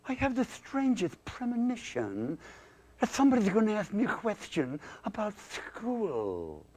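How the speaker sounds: high-pitched puppet voice; high voice, drawn into a mock-ominous premonition; schoolkid dummy with foreboding. Schoolkid dreads a question about school, high foreboding puppet voice.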